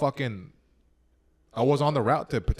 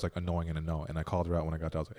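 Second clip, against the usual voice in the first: ordinary talk, nothing marked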